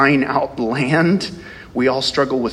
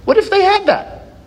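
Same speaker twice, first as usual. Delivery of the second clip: astonished what-if; 4 dB louder than usual. Real, not acted. Imagining a huge army.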